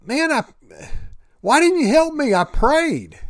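high-pitched, aggrieved protest; protesting complaint. Retold or imitated. Drowned guy asks God why, protesting complaint.

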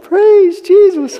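high, slow, drawn-out mock attempt; half-hearted praise. Young man's first try for the elder, awkwardly strained. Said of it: "say it like you mean it".

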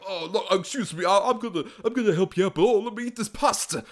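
hammed-up, high-pitched impression; Anthony Quinn. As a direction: play an oblivious character in his own world. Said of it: "'Cause he's just like".

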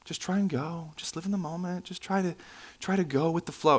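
soft, airy platitudes, lightly sent up; mock inspirational quotes. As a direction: toss out vague encouragement in a hushed mock voice. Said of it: "really weird random inspirational quotes".

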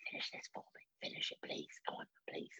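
urgent pleading whisper. Retold or imitated. Little brother secretly begging to finish his food, whispered. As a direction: whisper it so the parents can't hear.

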